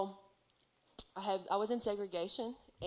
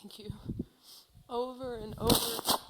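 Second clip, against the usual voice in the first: higher, shaky with real tears; still tearful; 4 semitones higher than usual. Real, not acted. Telling viewers how she cried to God, tearful. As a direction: push through the crying. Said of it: "Oh, I am so sorry".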